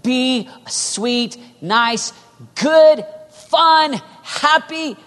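high, loud, word-by-word proclamation; mockingly cheerful slogan, building to a loud peak; mock culture's cry. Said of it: "The cry in our culture is".

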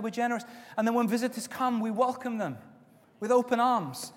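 normal talking voice

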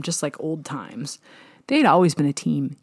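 ordinary delivery, nothing marked

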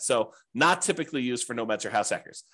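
ordinary talk, nothing marked

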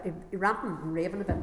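ordinary talk

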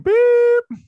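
high loud bleep sound; censor beep. Pretend.

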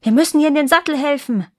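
high, loud, quick order; urgent princely command. A narrator's reading. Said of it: "befahl der Prinz".

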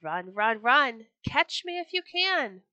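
playful sing-song dare, building in pitch; taunting call. A narrator's reading. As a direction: call back teasingly to your chasers. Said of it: "laughed and called out".

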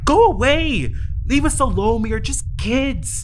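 high loud yell; loud high cry to go away; frightened shout. Acted. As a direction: scream him away. Child yells at trickster.

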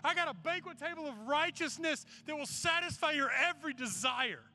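high, driving sermon delivery; impassioned preaching. Real, not acted. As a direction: preach it with rising urgency, leaning on key phrases. Preaches the story's lesson at full pitch.

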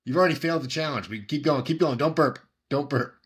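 high excited chanting; frat bro. Real, not acted. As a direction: hype up a friend like a coach. Cheering the chug.

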